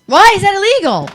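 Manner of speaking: high-pitched, baffled challenge; incredulous question. Real, not acted. Challenging his claim about the illegal hit, incredulous. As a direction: burst in with disbelief.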